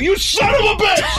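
high, drawn-out outraged yell; comic outburst, very high and slow; mock furious shout. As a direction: yell it after someone running off, hammed up. Acting out yelling at runaway dog.

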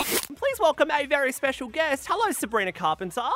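high, loud excited guest introduction; enthusiastic. Real, not acted. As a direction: welcome the guest with big enthusiasm. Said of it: "the enthusiasm in your voice".

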